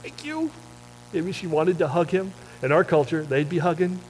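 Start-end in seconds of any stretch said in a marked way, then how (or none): 0.0-0.5 timid grateful woman. Pretend. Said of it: "looked at him and said".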